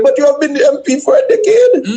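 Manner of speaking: high-pitched, incredulous laughing protest; laughing disbelief. Real, not acted. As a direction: laugh at the absurd excuse, pitch rising. Mocking the MP's excuse.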